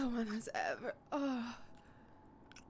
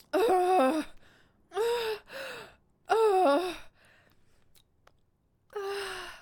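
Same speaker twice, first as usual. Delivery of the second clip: high loud repeated cries; loud ecstatic cries; 7 semitones higher, 10 dB louder, much slower than usual. Acted. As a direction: cry out at the peak.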